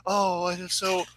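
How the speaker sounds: whiny moan. Retold or imitated. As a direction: moan it like a bad stage actor. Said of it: "acting like a total whiny little bitch".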